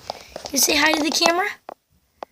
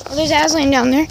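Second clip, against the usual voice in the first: normal talking voice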